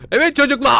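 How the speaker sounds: high shouting teacher calling the class; hammed-up teacher addressing kids; mock teacher. Gamer pretending to be a teacher calling the children. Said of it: "ben burada öğretmen olsam".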